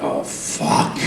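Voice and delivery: low breathy oath with a laugh; raspy whispered curse. Retold or imitated. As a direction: mutter it under a laugh, voice wrecked. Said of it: "I was like".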